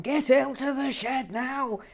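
loud, high spooky command; hammed-up ghost ordering you out; mock ghostly shout. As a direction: boom it out like a poltergeist. Poltergeist voiced in retold theory.

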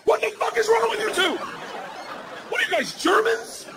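high-pitched, incredulous parental rant; comic dad at the end of his rope; exasperated dad outburst. Acted. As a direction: lay down the law in a strained high shout. Acts out dad scolding his daughters.

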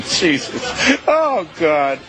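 anchor stifling giggles. Real, not acted. Anchor breaking up.

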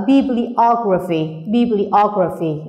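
slow bibliography with the O after bibli stressed. Shown as a demonstration. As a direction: stretch it out, leaning on the O in bibliography. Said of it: "nhấn ngay vào chữ O".

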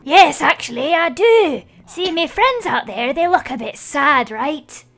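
light Irish-style lilt, leisurely asking a favour; pumpkin accent. Pretend. Pumpkin villager asks the players for coal in a light Irish-style accent. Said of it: "gotta have the true accent of this guy".

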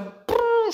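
very high rolled prrr; playful drill buzz; drill brrr. Pretend. Imitating the digger.

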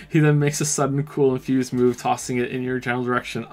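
ordinary delivery

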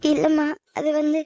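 timid protest. Acted. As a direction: try to explain meekly. Daughter tries to explain.

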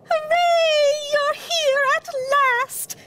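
very high, loud mouse cheer; drawn-out high hooray of tiny mice. A narrator's reading. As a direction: cheer slowly in squeaky delight. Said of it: "cheered the mice".

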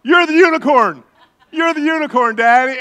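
high, loud imitated child shout; gleeful little girl. Preacher imitating his daughter springing the joke, gleeful. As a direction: crow the punchline like a delighted kid. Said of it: "And then she goes".

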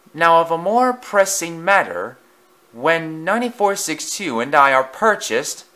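a snobbish engine mocking the others; pompous engine. A narrator's reading. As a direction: look down on every engine here. Voices the pompous loaned engine.

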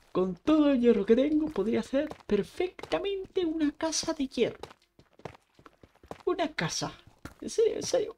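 high, eager rush about the idea; getting hyped over a crazy plan; excited. Real, not acted. Gamer hyping himself up about an iron house, high and excited.

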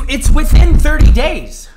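exasperated ranting; angry emphasis. Real, not acted. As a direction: press the point hard. Rant peaking again.

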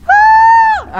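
very high, loud held shriek; long falsetto mock scream; high-pitched imitated scream. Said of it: "era una voz aguda, banda, así me estaba gritando".

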